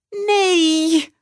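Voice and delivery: put-on high, loud, anguished boy's wail; loud cry. Max seeing the bus gone, wailing loudly. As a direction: cry it out in dismay. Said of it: "roept Max heel hard".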